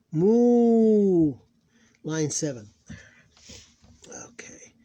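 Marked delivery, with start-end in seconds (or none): 0.1-1.3 long vowels held high and steady; syllable-by-syllable recitation. Shown as a demonstration. Teacher reading Arabic syllables aloud, measured recitation. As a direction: chant each syllable apart, stretching long vowels.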